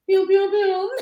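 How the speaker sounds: high, loud pew sounds; squealed toy-laser noises; imitated pew noises. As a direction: fire off playful high-pitched shots. Imitating shots as a joke.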